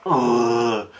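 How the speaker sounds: heavy drawn-out "ugh" of dismay; weary groan. Retold or imitated. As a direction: groan at how grim it all is. Reacting to how dark it was.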